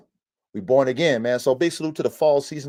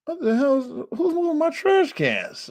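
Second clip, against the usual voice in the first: very high retold disbelief; puzzled alarm. Hearing his trash cans move, high-pitched mock alarm. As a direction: wonder it in a squeaky high register.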